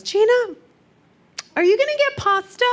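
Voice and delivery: squeaky niece asking; high little niece voice; little girl's voice. Retold or imitated. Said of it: "she goes".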